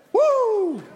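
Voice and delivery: high, loud happy woo; excited whoop. Real, not acted. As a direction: whoop to fire up the crowd. Pastor hyping the congregation.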